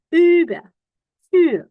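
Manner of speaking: loud, high "Über" and "U", vowel between U and I; U-umlaut sound. Shown as a demonstration. Teacher giving an example, emphatic U-umlaut vowel. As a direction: punch out "Über" and "U" with pouted I. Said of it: "mau nyobain ngomong U, tapi ada U".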